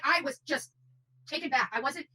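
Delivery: ordinary talk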